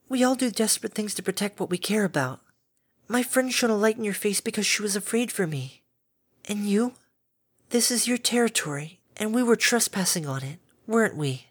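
calm a frightened beast kindly; gentle caretaker Fluttershy. A narrator's reading.

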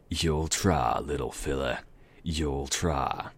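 smug southern drawl, easing off; southern accent. Acted. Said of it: "random southern accent".

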